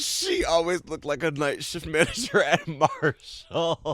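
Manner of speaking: laughing. Real, not acted. Mocking joke with laugh.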